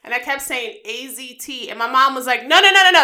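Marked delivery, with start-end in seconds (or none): 2.4-3.0 higher, louder rapid-fire objection; mom's urgent correction. Retold or imitated. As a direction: cut in as an alarmed mom correcting her child. Acting mom urgently correcting her.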